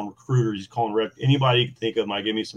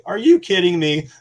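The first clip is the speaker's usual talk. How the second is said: incredulous; 9 semitones higher, 7 dB louder than usual. Retold or imitated. Reacting to speed-limit yells.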